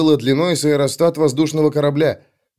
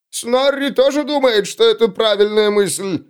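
much higher, simple-minded dwarf; dim dwarf voice; 9 semitones higher than usual. A narrator's reading. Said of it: "вставил Снорри".

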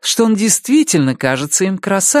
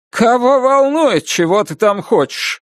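echoed bossy grandpa; 6 semitones higher than usual. Retold or imitated. Narrator echoes his words.

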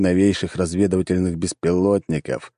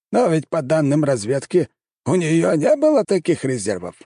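high, disbelieving objection; surprised; 9 semitones higher than usual. A narrator's reading. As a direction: object in raised-pitch surprise. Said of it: "удивлённо проговорил император". (с удивлением)

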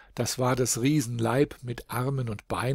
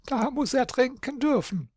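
much higher bleating goat voice on dürfen; 13 semitones higher than usual. A narrator's reading. Goat Schimmermilch agreeing the giant may drink, high bleat. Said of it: "meckerte die gute Schimmermilch".

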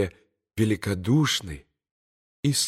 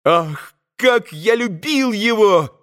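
put-on high, impassioned boy's outcry; soaring cry of affection; heartfelt exclamation; 10 semitones higher, 4 dB louder, slower than usual. A narrator's reading. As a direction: exclaim it as an overcome boy. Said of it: "воскликнул Коля".

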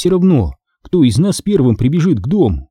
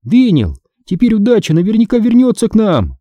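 high, happy assurance; joyful; 10 semitones higher than usual. A narrator's reading. Girl telling her brother luck will return, joyful. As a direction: share the good news gladly. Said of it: "радостно добавила я".

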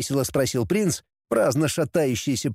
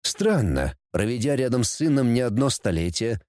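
ordinary delivery

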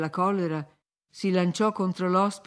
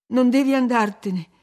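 louder, higher, clinging protest; desperate insistence; 4 semitones higher, 7 dB louder than usual. A narrator's reading. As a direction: refuse to let him go. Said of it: "rispose lei trattenendolo con tutta la forza".

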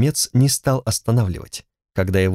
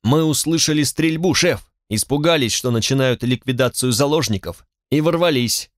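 plain reading voice

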